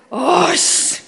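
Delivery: high, drawn-out mock "osh"; hammed-up groan of impatience; exasperated groan. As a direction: huff in comic exasperation. Jesus acted exasperated at Marta.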